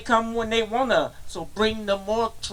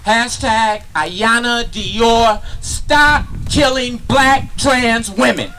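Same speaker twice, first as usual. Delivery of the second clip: louder, rising into a full shout; protest shout; 2 semitones higher, 6 dB louder than usual. Acted. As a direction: call it out like a rally chant. Poem climax, protest call.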